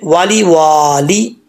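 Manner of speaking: slow वाली वाली, only the second वा held; short then long. Shown as a demonstration. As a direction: hold the वा of the second word only. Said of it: "दूसरे वाव को लंबा करना है".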